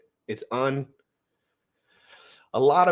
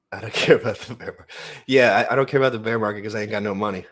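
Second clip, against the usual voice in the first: laughing while joking; faster than usual. Real, not acted. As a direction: chuckle through the words, amused. Joking about having no money.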